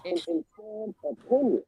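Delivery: muffled, like through a wall. Real, not acted. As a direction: talk as if behind a closed door. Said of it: "You muffled".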